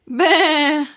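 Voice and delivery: loud high bleat; sheep bleat. Acted. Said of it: "said Sheep".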